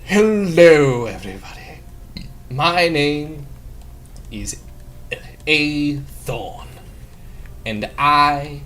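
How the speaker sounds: a lightly voiced showman; a hint of grand ringmaster flair; showman introduction. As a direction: announce yourself like a stage performer. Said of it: "he's all like".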